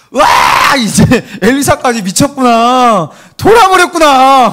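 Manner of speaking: loud, high hysterical shout; panicked screaming. Acted. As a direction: servant screaming that the master has lost it. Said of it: "한 번 더 소리를 질렀을". (당황해서 높게 내지르는 고함)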